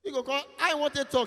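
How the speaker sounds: usual speaking voice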